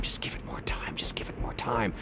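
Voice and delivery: urgent hushed whisper. Retold or imitated. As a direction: whisper so the killer won't hear. Lori urging quietly during ambush.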